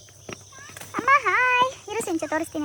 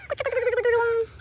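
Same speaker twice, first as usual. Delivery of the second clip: calling noise; 5 semitones higher than usual. Real, not acted. Calling sound to animal.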